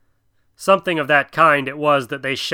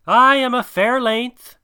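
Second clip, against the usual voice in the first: proud; 10 semitones higher, 3 dB louder than usual. Acted. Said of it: "said Kaa with a little pride".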